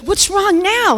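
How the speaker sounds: high, loud alarmed question; alarmed call. Retold or imitated. Doctor rushing over, loud and alarmed. Doctor reacts to her scream.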